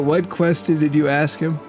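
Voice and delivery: ordinary talk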